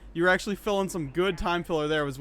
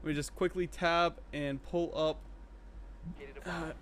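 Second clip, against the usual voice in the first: ordinary delivery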